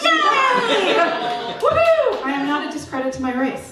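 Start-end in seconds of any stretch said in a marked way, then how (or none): 0.0-2.2 high, bright mock whoop of joy; mock cheer. Comic cheering about being called a masshole, mock whoop. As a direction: cheer it like a tiny victory. Mock celebrating her Massachusetts plates.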